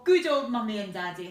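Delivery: ordinary delivery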